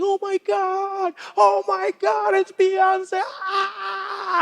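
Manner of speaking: high, drawn-out squeal of a starstruck fan; hysterical fan. Retold or imitated. American fans spotting a celebrity in the street, hysterical. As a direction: shriek it hysterically, stretching the words. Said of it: "you see people spotting a celebrity".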